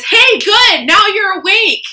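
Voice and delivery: high, loud, bright-eyed wake-up call; a big, sunny voice from above; spirit's voice. Retold or imitated. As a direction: chirp it like a cheerful spirit rousing you. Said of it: "when spirit says".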